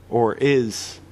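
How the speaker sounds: ordinary delivery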